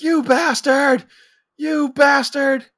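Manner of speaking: very high, drawn-out mock yell; retold yelling. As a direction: imitate a freaked-out skier yelling, stretching the words. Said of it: "he's yelling at Roman".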